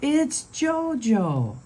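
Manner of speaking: high, slow reveal; story reveal, high and slow; big reveal. A narrator's reading. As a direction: draw it out like a surprise ending. Reveal of the thief.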